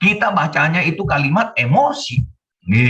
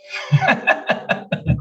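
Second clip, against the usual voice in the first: high, voiceless burst of laughter; laughing; 3 dB quieter than usual. Real, not acted. Preacher amused by his own household example, high wheezy laughing. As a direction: break into real high giggles.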